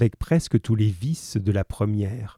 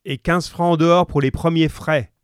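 higher-pitched call from the back room; man calling from within; 7 semitones higher, 3 dB louder than usual. A narrator's reading. Husband shouts terms from the back, a contained call. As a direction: call out the demand, no louder. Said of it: "ajouta la voix d'homme".

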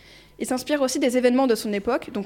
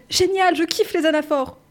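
excited; 5 semitones higher than usual. Retold or imitated. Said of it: "ouvrez les guillemets".

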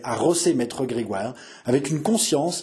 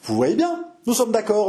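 composure; 7 semitones higher than usual. A narrator's reading. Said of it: "avec un grand sang-froid".

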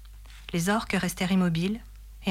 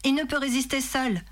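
mild narrated cry; 7 semitones higher than usual. A narrator's reading. Aragorn running back onto the bridge, mild cry. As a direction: call out suddenly, keeping the volume contained. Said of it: "cria soudain Aragorn".

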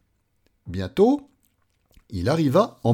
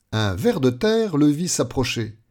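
ordinary delivery

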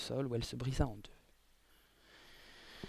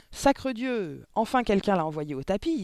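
loud, higher gleeful cry; exclaiming; 6 semitones higher, 6 dB louder than usual. A narrator's reading. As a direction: crow it with delight. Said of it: "s'écria l'un des hommes".

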